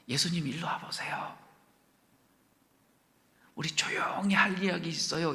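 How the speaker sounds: hushed, breathy aside to Jesus; secret whisper. Shown as a demonstration. Two disciples whispering a secret request to Jesus. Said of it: "이렇게 말한 게 아니라 대놓고 말했어요".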